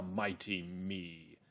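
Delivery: "mighty me" said slowly, ME drawn out; stress on ME. Shown as a demonstration. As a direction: stretch "me" slowly at the end. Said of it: "So you can stress those two words".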